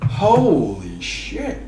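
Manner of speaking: low, soft, very drawn-out reaction; real hushed amazement; stunned disbelief. Real, not acted. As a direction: let it out slowly and low, stunned. Stunned after the finish.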